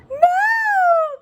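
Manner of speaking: high screaming no; terrified cry. Acted. Witch fleeing the dragon, screaming in terror. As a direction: shriek it in panic. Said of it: "cried the witch".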